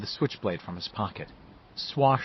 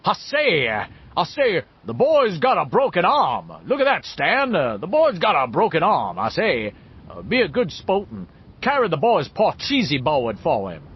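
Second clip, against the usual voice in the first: loud, swooping Southern gentleman bluster; Foghorn Leghorn; 6 semitones higher, 5 dB louder than usual. A narrator's reading. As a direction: roll it out grandly like a Southern cartoon orator. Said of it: "in his grandly rolling Southern gentleman voice".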